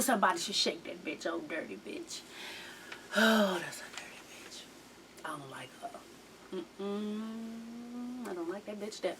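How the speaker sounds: very low, hushed disdain; muttered disgust. Real, not acted. Viewer who dislikes the mother, muttered disgust. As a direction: mutter it almost to yourself, fading toward a whisper.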